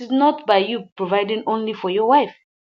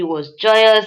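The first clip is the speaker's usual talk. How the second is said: ordinary delivery, nothing marked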